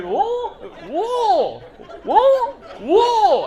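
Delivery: repeated "Wool?" high and loud, straining the vowel; trying to say wool. Retold or imitated. Comic straining to say the local "Wool" for the crowd. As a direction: keep failing at "Wool", pitch climbing. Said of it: "unable to go full wool".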